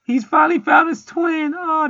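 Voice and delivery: high, slow, hammed-up crying wail; mock crying. Vlogger mocking tearful women viewers, high hammed-up sob. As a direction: wail it like a weepy fan, overdone. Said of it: "It's females crying".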